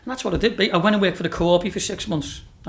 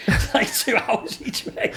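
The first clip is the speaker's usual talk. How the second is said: laughing; 7 semitones higher, much slower than usual. Real, not acted. Laughing while retelling.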